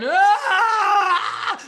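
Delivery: high loud laughing cry of despair; overacted scream of frustration; hammed-up anguished shout. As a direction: burst out in a mock wail. Acted server snapping at refusal.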